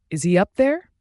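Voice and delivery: loud, high call up the stairs. A narrator's reading. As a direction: call from downstairs. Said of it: "Katie calls from downstairs".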